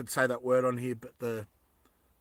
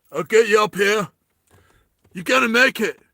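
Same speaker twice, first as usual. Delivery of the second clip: raised, louder action-hero promise; Sylvester Stallone; 5 semitones higher, 6 dB louder than usual. Acted. Climber calling to someone he's rescuing, urgent action-hero impression. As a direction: act a loud movie rescuer. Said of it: "uh, Sylvester Stallone".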